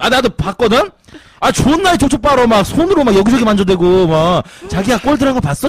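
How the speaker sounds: loud, worked-up comic disgust; high, punchy outraged storytelling; indignant rant. Real, not acted. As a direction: complain heatedly, piling up the details. Comic rant about kissing couples.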